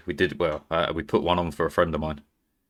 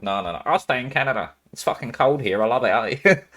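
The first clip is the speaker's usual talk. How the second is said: lightly voiced mock of a friend who loves the cold; a hint of a stubborn Canada lover, teasing; impression of Calista; 4 semitones higher than usual. Streamer teasing her friend about staying in Canada, lightly mimicked. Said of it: "That's, like, the worst impression I've ever done".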